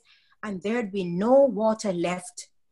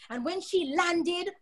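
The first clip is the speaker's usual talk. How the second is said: ordinary delivery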